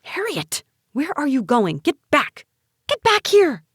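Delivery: high-pitched, urgent hissed call; frantic high hissing. A narrator's reading. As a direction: hiss after someone who is getting away. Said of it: "Aunt Jerry hissed".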